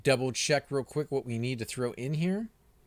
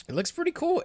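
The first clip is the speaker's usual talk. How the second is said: excited; 5 dB louder than usual. Real, not acted. Admiring the robe.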